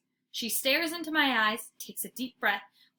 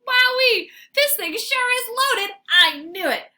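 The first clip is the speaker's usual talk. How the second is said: very high, loud delight; excited dog discovery; thrilled dog; 13 semitones higher, 9 dB louder than usual. A narrator's reading. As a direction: squeal with glee. Dog finds treat bag.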